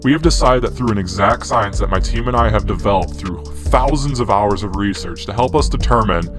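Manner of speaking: ordinary talk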